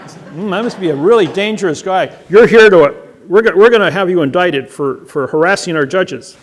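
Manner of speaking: facing the officers, loud and heated; loud confrontation. Retold or imitated. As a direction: push back at them.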